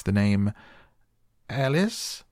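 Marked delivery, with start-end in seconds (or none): 1.5-2.2 high, piping call, rising at the end; small, high herald's cry; shrill little voice. A narrator's reading. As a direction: read out the name in a shrill squeak. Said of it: "at the top of his shrill little voice".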